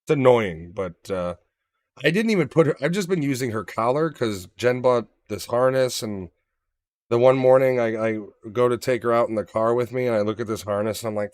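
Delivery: ordinary talk, nothing marked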